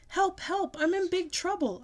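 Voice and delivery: high panicked yell; loud, high yell to Mother. A narrator's reading. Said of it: "I yelled to Mother".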